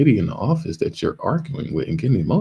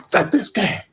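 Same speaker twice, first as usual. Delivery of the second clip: high, loud grandma snapping; mad grandma; 4 dB louder than usual. Retold or imitated. Grandma dumping the Monopoly table, high angry cry. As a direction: play the grandma ending the game in anger. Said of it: "She mad".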